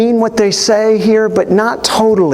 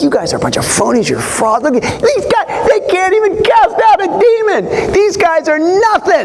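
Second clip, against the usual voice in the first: mocking religious leaders; 9 semitones higher than usual. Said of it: "they were probably saying something like".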